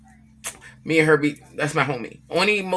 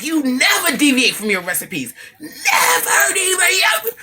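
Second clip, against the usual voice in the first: much higher and louder mock yell, laughing through it; brother going off; 13 semitones higher, 4 dB louder, slower than usual. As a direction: replay the brother scolding at full pitch. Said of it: "My brother went off".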